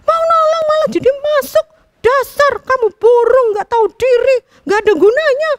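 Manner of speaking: high, loud furious berating; trapped bulbul yelling at the sparrow, high and loud; angry scolding. A narrator's reading. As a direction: tear into the clumsy sparrow. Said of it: "Kutilang semakin marah".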